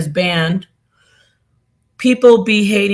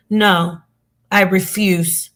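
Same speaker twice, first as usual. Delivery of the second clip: ordinary talk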